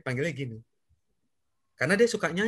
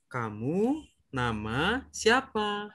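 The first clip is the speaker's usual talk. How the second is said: kamu nama siapa? drawn out very slowly; slow demo question; much slower than usual. Shown as a demonstration. Teacher signs the question to Davi, very slow. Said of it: "[name] akan coba lihat".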